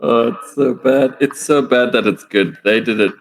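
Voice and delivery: ordinary talk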